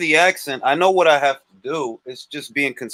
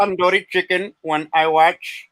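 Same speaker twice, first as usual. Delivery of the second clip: mock Indian English; hammed-up Indian accent. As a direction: put on a caricatured Indian accent. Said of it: "very, very offensive thing to be doing".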